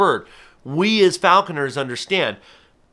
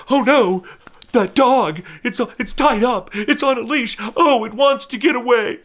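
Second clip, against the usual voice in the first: high, fretful bystander voice, much higher; mock alarmed onlooker; 11 semitones higher, 3 dB louder than usual. Falconer mocking a passerby who pities a leashed dog, high and fretful. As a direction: play a pearl-clutching passerby fretting over a leashed dog. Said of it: "Nobody's like".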